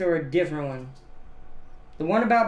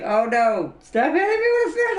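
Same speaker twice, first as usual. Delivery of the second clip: much higher, fast, hammed-up panicked shout; mock panicked victim; 11 semitones higher than usual. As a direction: squeal in mock panic as the fish keeps hitting. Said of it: "It's like".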